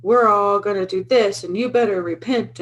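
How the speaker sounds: loud, slow hammed-up preacher; mock doomsday preacher. Woman mocking a crazy preacher, hammed-up loud. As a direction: imitate a fire-and-brimstone crank, mockingly. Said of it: "some crazy person who's like".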